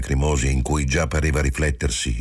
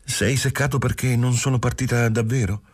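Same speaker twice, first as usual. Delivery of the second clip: a touch of fear; 8 semitones higher than usual. Acted. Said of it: "tremava ancora qualche timore".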